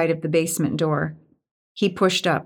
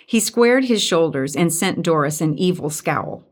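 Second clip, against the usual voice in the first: ordinary delivery, nothing marked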